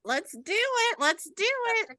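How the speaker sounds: high-pitched, eager cheer; eager kickoff. Real, not acted. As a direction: kick things off with playful high excitement. Host eagerly kicks off the talk.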